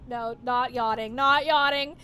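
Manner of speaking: slow then loud denial, stress on "not"; protesting yawn. Real, not acted.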